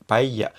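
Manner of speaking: put-on paella with the old palatal ll, /paiʎa/; original ll paella. Shown as a demonstration. Teacher showing the old Spanish ll. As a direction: start the ll tongue like an L. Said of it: "empezando con la lengua como si fuera una L".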